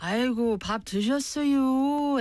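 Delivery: playful Korean Chungcheong dialect greeting. Retold or imitated. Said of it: "I can't do a really good one".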